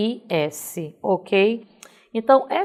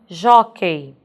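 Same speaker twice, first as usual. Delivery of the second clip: "jockey" slowly, with a louder JÓ; stress on JÓ; 5 dB louder than usual. Shown as a demonstration. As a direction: punch the first syllable of "jockey". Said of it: "nossa sílaba mais forte é o jó". ("jockey" pausado, com JÓ forte)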